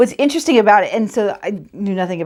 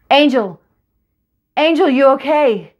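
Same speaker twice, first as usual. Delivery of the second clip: loud, high urgent shout; father running up, shouting for his daughter; worried father's call; 6 semitones higher, 5 dB louder than usual. Acted.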